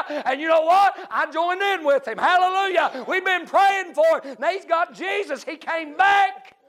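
high, loud revival preaching; full-force preaching. Real, not acted. Preacher telling church he joined the deacon's praise, full-force preaching. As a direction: preach it out in rising excitement.

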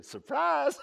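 very high, loud yell into laughter; gleeful surprise shout. Pretend. Preacher springing surprise on woken sleepers, high gleeful shout. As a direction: burst it out and cackle.

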